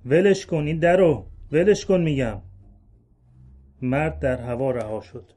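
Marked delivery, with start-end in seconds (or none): 0.1-2.4 loud barked order, higher and louder; angry yell. Acted. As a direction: roar it as a threat. Thugs forcing man off wagon. (فریاد تهدیدآمیز)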